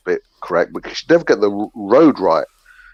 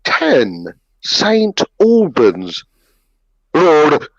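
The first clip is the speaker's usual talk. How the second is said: very slow, higher imitation of mum; mock-proper address, painfully spaced out; mum's careful English; 5 semitones higher, much slower than usual. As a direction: labour over each word, then blurt a botched "Road". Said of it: "She can never get the road right".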